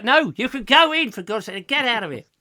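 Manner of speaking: high, loud, hammed-up exasperation; comic fed-up yell; mock exasperated shout. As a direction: send them packing, at the end of your patience. Acted exasperation at gullible guest.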